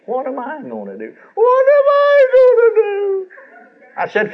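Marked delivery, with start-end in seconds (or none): 0.1-1.1 mock self-pity. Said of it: "selfish tears". 1.3-3.3 high, loud, drawn-out mock wail, slowed down; mock self-pity wail. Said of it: "selfish tears".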